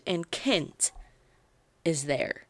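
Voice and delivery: usual speaking voice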